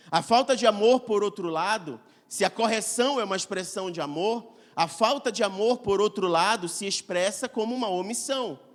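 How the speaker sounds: usual speaking voice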